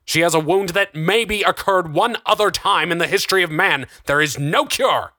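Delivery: high, exasperated insistence; heated argument. A narrator's reading. Darren in shouted argument.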